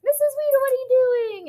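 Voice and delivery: high, loud imitation of thrilled kids; excited pupil greeting. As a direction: call out like a pupil spotting a favourite teacher. Former students excitedly greet their teacher.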